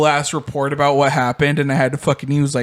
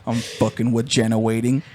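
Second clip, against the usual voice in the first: put-on low mumbling kid; 4 semitones lower, 4 dB quieter than usual. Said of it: "Dead ass said that".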